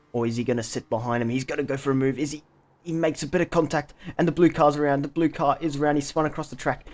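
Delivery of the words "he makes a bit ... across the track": excited, higher-pitched live crash call; opgewonden racecommentaar. Real, not acted. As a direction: react live as the car spins, voice lifted. Rival car spins after contact.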